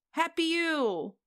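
high, loud joyful yell; shrieking happy toast; near-scream. Acted. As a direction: scream it out in drunken glee. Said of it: "Tally practically screams".

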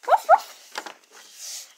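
high, breathy little barks; dog barking. Pretend. The dog barks at the door to get in. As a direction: yap like a small dog.

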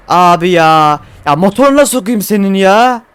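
loud cursing at the engine; angry outburst. Real, not acted. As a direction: vent at the stuck truck. Cursing the broken truck. (motoruna sövüyor, öfkeyle)

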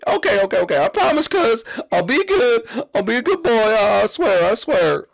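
high, eager little Koopa promise; Iggy voice. Acted. Iggy promising his brother Roy he'll be good, high and eager. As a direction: promise sweetly to behave.

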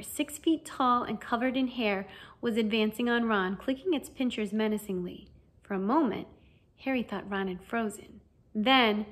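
ordinary delivery, nothing marked

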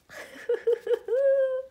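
high, loud squealing laugh close to tears; delighted laugh. Real, not acted. Unboxing her new doll for viewers, squealing happy laugh.

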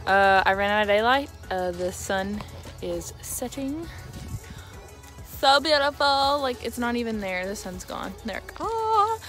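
usual speaking voice

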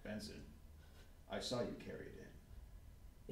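soft, slow, unvoiced threat; questioning Benson quietly, menacing; quiet menace. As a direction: lean in and say it low.

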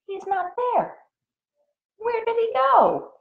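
gasp, then very high, hushed surprise; shocked reveal. A narrator's reading. Reader reveals the pig is gone, high gasping surprise. Gasped reveal after suspense pause.

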